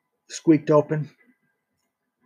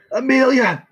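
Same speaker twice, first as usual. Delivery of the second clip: loud high call across the house; 10 semitones higher, 4 dB louder than usual. Acted. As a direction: call her name sharply from the bed. Dying man calls for girl.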